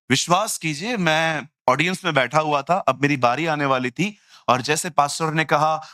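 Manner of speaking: ordinary talk, nothing marked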